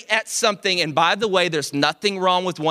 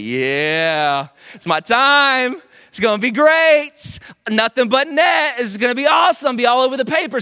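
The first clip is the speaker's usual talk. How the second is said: cocky excitement; 4 semitones higher, 4 dB louder than usual. Retold or imitated. Pippen eager for the last shot.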